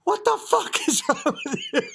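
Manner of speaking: high mock outrage, cracking up; helplessly laughing impression of scolding; mad wife, through laughter. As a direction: imitate a furious wife while laughing. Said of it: "so mad at him".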